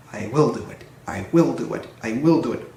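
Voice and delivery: brisk low rhythmic puff; puffing rhythmically. A narrator's reading. Edward pushing Gordon uphill, brisk puffing chant. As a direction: chug the words like pushing hard. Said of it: "puffed Edward".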